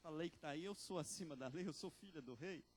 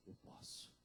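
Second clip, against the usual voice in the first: God's answer in a whisper; whispered reply; 8 dB quieter than usual. Acted. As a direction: breathe Eu posso as a divine whisper. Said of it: "o Senhor diz".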